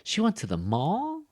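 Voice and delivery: "mall" climbing very high; incredulous echo question rising on "mall"; surprised tag question. Shown as a demonstration. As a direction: send "mall" sharply up, astonished. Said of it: "like, showing surprise".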